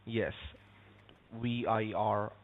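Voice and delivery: normal talking voice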